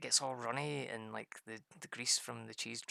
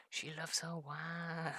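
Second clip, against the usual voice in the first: slow amused drawl; 5 semitones higher, much slower than usual. Real, not acted. Wife enjoys the wine.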